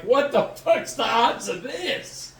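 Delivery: high, slow, drawn-out incredulity; mock disbelief. Driver hearing of last night's DUI, mock disbelief. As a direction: drag it out in exaggerated disbelief. Retold disbelief at bad luck.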